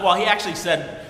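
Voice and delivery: normal talking voice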